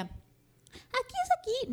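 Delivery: voz de niñita de títere mucho más aguda; voz de niña. Retold or imitated. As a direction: pregunta con vocecita de niña inocente, muy lento al final. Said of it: "animen esta voz". (vocecita aguda de niña de Plaza Sésamo)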